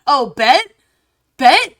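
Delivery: loud, high, cocky mock challenge; imitating eager viewers. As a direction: act out a crowd taking up a dare. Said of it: "everyone's going".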